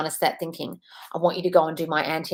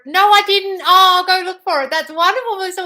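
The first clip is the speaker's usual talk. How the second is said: excited; 13 semitones higher, 9 dB louder than usual. Real, not acted. Excitedly answering a viewer.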